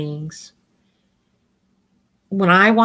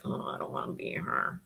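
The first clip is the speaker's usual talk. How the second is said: put-on whispered, mumbled kid's complaint; whispered sulky student; whispered bored kid; 16 dB quieter than usual.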